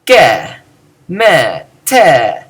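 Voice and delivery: ge, me, te each punched loud; emphatic enclitics. Shown as a demonstration. Teacher showing newly emphasized enclitics, each one stressed. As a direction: hit ge, me, te separately with falling force. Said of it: "are going to be emphasized".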